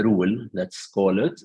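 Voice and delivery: ordinary delivery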